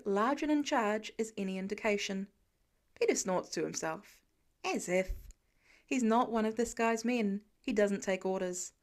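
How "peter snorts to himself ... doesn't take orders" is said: plain reading voice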